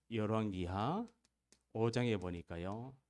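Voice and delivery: ordinary talk, nothing marked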